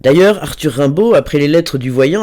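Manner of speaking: ordinary delivery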